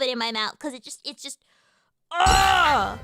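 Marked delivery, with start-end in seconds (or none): 2.1-3.0 loud, drawn-out groan of craving; high, loud exasperated "ugh"; frustrated "ugh". Real, not acted. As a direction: let out a big overwhelmed groan. Overwhelmed by how good accents sound.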